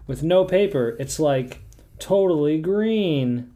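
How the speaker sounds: high, loud and drawn-out smug boast; smug comic husband. Retold or imitated. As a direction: stretch out totally green, very pleased with himself. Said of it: "and he says".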